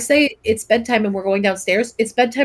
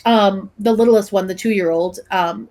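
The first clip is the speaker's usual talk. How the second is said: ordinary talk, nothing marked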